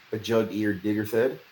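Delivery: plain reading voice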